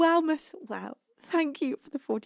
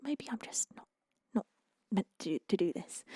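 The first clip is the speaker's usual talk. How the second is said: whispered self-doubt; 4 semitones lower, 7 dB quieter than usual. Retold or imitated. Recalling self-doubt, inner voice quoted.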